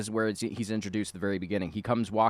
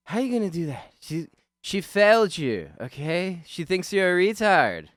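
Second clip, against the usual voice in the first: higher, louder, slow sneering voice; mocking high taunt; 10 semitones higher, 8 dB louder than usual. Retelling a movie scene, the bully taunting him, loud and high.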